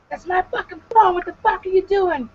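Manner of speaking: high-pitched angry outburst; imitated mad man. Man angry that she answered his phone, mock furious. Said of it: "the whole thing he was mad about".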